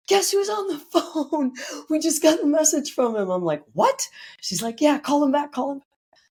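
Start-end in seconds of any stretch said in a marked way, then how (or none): 0.1-3.3 high, thrilled announcement; excited news. Retold or imitated. Girlfriend rushing in to announce his call, high and excited. 3.7-4.1 high disbelieving yelp; a sudden high gasp of shock; shocked what. Retold or imitated. Hearing the therapist called back, shocked and high. 4.8-6.2 eager urging. Retold or imitated. Girlfriend urging her.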